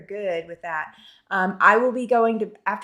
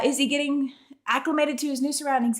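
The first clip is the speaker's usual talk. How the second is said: normal talking voice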